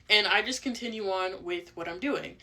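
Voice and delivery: normal talking voice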